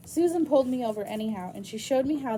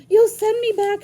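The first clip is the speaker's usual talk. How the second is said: panicked plea; 11 semitones higher, 13 dB louder than usual. Acted. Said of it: "I said".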